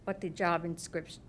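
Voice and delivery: usual speaking voice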